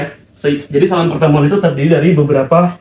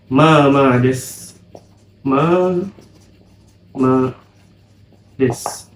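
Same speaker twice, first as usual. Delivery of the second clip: very slow, syllable by syllable; slow demo; much slower than usual. Shown as a demonstration. Teacher drills the reply slowly for students. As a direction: spell out "Ma, ma des" slowly.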